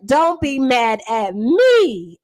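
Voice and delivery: ordinary talk, nothing marked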